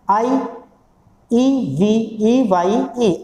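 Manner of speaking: letters spelled very slowly; spelling out. Shown as a demonstration. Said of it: "अब ये phonetic symbol पूछता है".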